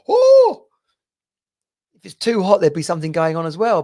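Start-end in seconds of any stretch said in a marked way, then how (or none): 0.1-0.6 sharp high yelp of shock, much higher and louder; cold water gasp. Retold or imitated. Diving into freezing sea, sudden high loud "Oh!". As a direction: jolt out an "Oh!" like hitting icy water. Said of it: "you're probably gonna go".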